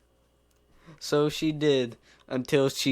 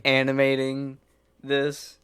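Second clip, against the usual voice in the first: ordinary delivery, nothing marked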